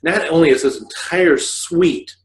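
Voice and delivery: normal talking voice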